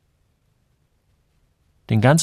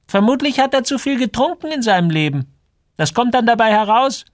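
very high, louder gossiping voice; judgemental shopkeeper woman; 5 dB louder than usual. A narrator's reading. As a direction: pitch it way up, passing judgement as the shopkeeper. (hohe, vorwurfsvolle Ladenfrau)

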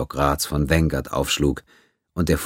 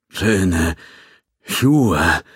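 gurgled, strained words pushed out higher; gurgling; 3 semitones higher, 5 dB louder than usual. A narrator's reading. Beaten Quinn gurgles up at Seth, his mouth full of blood. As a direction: choke it out through a bloody mouth. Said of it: "gurgelte er, wobei blutige Speichelblasen aus seinem Mund tropften".

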